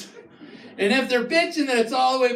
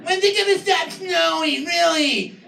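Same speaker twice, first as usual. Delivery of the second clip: whiny local; 6 semitones higher than usual. Retold or imitated. Comic mocking locals who complain about too much snow. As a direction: moan like you're sick of snow. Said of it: "everybody's like".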